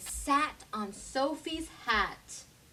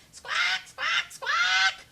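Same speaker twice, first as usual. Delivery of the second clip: high, loud bird squawks; shrill bird screech; 4 dB louder, much slower than usual. A narrator's reading. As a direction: screech like greedy birds. Birds follow squawking.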